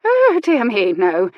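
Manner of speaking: high, loud delighted denial; with relish. Acted. Old lady tells the reverend she has no regrets, delighted and loud. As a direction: relish saying no. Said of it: "she said with relish".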